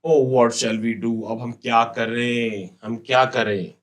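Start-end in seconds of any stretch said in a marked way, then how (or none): none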